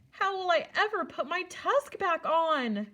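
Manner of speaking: put-on a touch of a childish wail; young god whining over a broken tusk; mild wail. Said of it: "Ganesha wailed".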